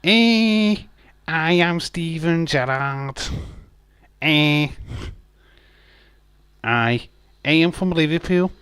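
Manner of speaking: put-on Scouse accent, pitched high and bright; Liverpool accent. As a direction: play a cheeky Liverpool lad. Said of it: "but my Liverpool".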